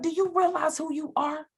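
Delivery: high, mildly heated mock scolding; mad friend. Best friend scolding her over dating him, imitated indignation. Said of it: "She was so mad at me".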